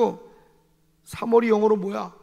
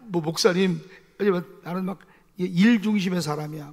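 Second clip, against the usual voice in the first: ordinary talk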